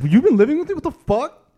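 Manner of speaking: high-pitched comic disbelief; hammed-up astonishment; mock shock. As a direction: act shocked, voice leaping way up. Plays shock at secret housemate.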